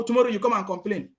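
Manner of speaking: normal talking voice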